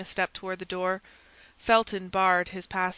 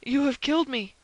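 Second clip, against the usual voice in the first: higher-pitched dying accusation; anguished cry; 6 semitones higher, 4 dB louder than usual. A narrator's reading. Stabbed Buckingham accusing Felton, crying out. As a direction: cry out to the attacker. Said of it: "cried Buckingham".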